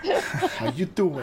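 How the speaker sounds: slow, drawn-out laughing greeting; flirty New York "how you doing"; Joey-style greeting. Pretend. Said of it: "doing the "How you doing" back and forth".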